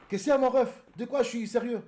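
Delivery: loud, high-pitched challenge; indignant disbelief. Real, not acted. Streamer answering a chatter, indignant. Indignant comeback to a chat message.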